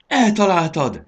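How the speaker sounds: high, loud cry of joy; delighted cheer. A narrator's reading. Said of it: "örvendezett Fanny". (örvendező, hangos felkiáltás)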